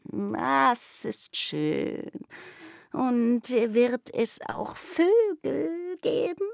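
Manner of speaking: slow, dreamy, longing cat; curious kitten. Acted. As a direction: purr the question slowly. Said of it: "fragte die Katze neugierig".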